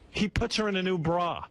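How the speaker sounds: ordinary talk, nothing marked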